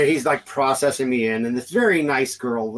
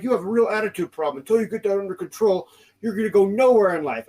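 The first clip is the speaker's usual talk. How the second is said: high, preachy mock cop voice; insistent, hammed-up officer's telling-off; lecturing officer; 7 semitones higher, faster than usual. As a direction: imitate a scolding lecture, pitched up. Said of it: "like, lecturing me".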